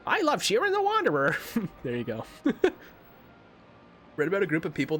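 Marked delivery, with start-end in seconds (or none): 0.1-1.4 squeaky high cartoon voice; high, strained puppet voice; Mister Chapstick impression. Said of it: "Do a Mister Chapstick impression".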